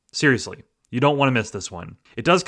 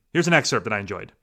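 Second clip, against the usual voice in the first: normal talking voice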